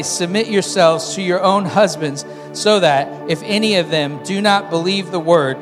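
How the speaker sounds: loud unison Bible reading; scripture reading. Acted. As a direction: read the verse aloud with them.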